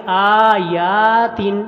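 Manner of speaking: very slow, chanted recitation; careful tajweed chant; slow Quran recitation. Shown as a demonstration. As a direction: draw out the doubled letters in the chant. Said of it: "حمزہ کے اوپر بھی کھڑی زبر ہے".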